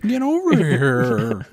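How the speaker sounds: put-on high, slow old geezer voice; old man voice. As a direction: drawl it like a grandpa.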